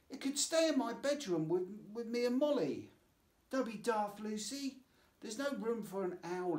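put-on lightly high child voices; girl's voice. Sisters arguing over an owl, lightly high child voices.

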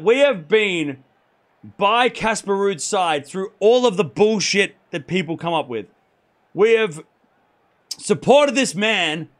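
slow, emphatic declaration, weighting each phrase; slow it right down and press every phrase home; impassioned declaration. Real, not acted. Streamer defending his favourite player to chat, impassioned.

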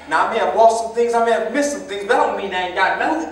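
loud, defiant retort; angry shout. Acted. Son shouting back at his accusing father, loud and defiant.

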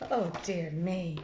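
plain reading voice

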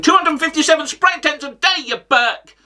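mock outraged rant. Comic ribbing the pundit over spray tans, mock outrage. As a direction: climb high into an exaggerated insult.

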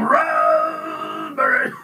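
huge, high, slow ecstatic shout. A narrator's reading. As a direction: cry it out to the sky.

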